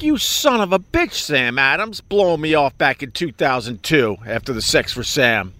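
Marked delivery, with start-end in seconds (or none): 0.0-2.0 high, indignant cry at a beer brand; hammed-up fake anger; mock outrage. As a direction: curse out the brand in mock fury. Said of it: "And I'm like".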